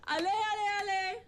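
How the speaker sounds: high, urgent urging; urgent hurrying. Acted. Woman rushing the others out, urgent hurrying. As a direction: hurry them along, pitch jumping up.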